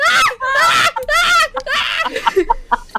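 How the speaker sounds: series of high, loud screeches; panicked screech. Acted. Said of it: "She screeches. She goes".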